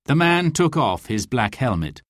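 ordinary delivery, nothing marked

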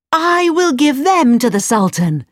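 triumphant idea shouted; cried out. Acted. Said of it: "cried Aladdin".

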